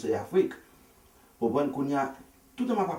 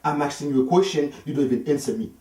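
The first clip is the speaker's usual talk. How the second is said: higher, louder furious snap; angry shout; 6 semitones higher, 6 dB louder than usual. Retold or imitated. Husband cutting off his wife, shouting at her higher and louder. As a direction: shut him down hard. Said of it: "And she said".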